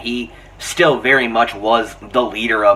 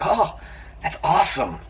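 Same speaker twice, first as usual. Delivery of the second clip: acted amazement; 7 semitones higher than usual. Acting out Tobey's amazed reaction.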